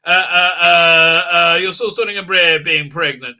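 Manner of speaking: loud, slow, hammed-up admiration; exaggerated fawning, hit hard; mock gushing. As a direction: send up preachy praise, stretching it out. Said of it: "very blatant propaganda".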